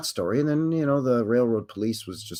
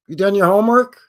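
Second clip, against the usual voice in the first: stern parent; 6 semitones higher, 7 dB louder than usual. Acted. Parent replying to kid.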